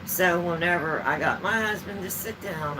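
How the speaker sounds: ordinary talk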